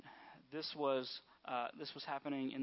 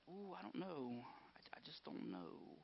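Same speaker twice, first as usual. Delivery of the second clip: hesitant mock doubter; 11 dB quieter, slower than usual. Hesitant churchgoer voiced mockingly.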